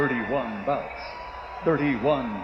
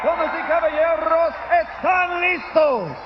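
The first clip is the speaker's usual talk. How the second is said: ring announcer shout; 13 semitones higher, 5 dB louder than usual. Acted. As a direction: call it out to the whole arena. Announcer hypes crowd in Spanish.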